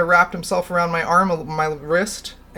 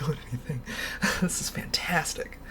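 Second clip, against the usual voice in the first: whisper; 9 dB quieter than usual. Real, not acted. Streamer aside to chat.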